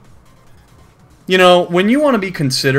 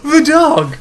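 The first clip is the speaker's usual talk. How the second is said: words breaking into real laughter, much higher; giggling helplessly through it; laughing. Real, not acted. Cracking up at the ending.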